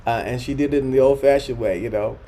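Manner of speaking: ordinary talk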